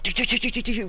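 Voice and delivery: mimicking fast building. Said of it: "We were like".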